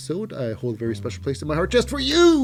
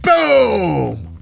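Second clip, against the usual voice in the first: shouted; 7 semitones higher, 8 dB louder than usual. Real, not acted. Calling a big kick.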